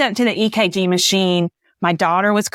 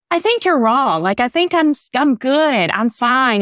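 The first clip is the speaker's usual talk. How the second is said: higher, brushing-off denial; breezy higher-pitched denial of anything wrong; insisting I'm fine; 6 semitones higher than usual. Retold or imitated. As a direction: wave the doctor off, I'm totally fine. Insisting to the doctor she's fine.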